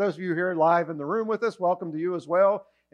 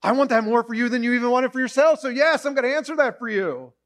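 higher, quick enthusiastic yes; eager promise; 5 semitones higher, 4 dB louder, faster than usual. Retold or imitated. God eagerly promising a way out. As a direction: reassure them gladly. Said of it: "God's going".

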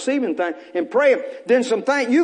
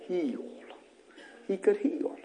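ordinary talk, nothing marked

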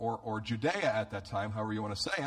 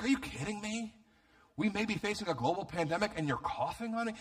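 retold high-pitched indignation, held down in volume; bitter disbelief; 6 semitones higher than usual. To the guy who coughed on him, bitter disbelief. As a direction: replay your bitter, incredulous protest in a higher voice. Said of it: "I was so bitter".

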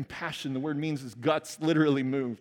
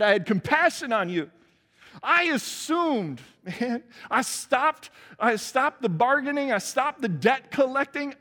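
much higher and louder, slow, building; impassioned master; 9 semitones higher, 5 dB louder than usual. Acted. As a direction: pour out the whole cost. Master voiced in parable, rising passion.

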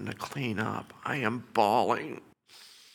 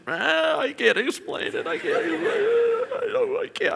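much higher, breaking laugh-cry voice; overwhelmed therapist; 5 dB louder than usual. Retold or imitated. Therapist answering the father, high laughing disbelief. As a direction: gasp it out, overcome with joy.

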